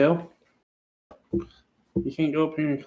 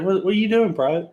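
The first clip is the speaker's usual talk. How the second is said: drill sergeant; 6 semitones higher, 4 dB louder, faster than usual. Retold or imitated. Drill sergeant catches him on floor.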